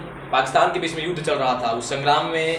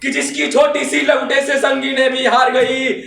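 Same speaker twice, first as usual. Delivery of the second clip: high, louder rousing verse; fiery declamation; 10 semitones higher, 6 dB louder than usual. Acted. Reciter honouring the freedom struggle, fiery declamation. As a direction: proclaim it to the whole hall. Verse repeated at full force.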